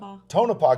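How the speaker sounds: "Tonopah" with a long OH in the first syllable; corrected place name. Real, not acted. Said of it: "Got it".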